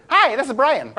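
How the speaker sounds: high, loud chirpy "Hi, this is Brian"; perky mismatched greeting. Shown as a demonstration. Caller greeting a flat voice far too brightly. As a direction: bounce in much too bright. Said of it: "would you say".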